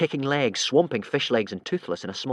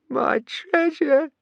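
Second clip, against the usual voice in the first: very high, slow wailing; crying; 3 dB louder, much slower than usual. A narrator's reading. Alvin waking and wailing for lost treasure, sobbing. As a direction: wail like a baby. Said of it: "he cried".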